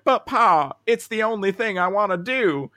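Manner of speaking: put-on high, loud whiny cowboy kid. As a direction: beg Pa like a frontier kid. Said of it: "more cowboy voices".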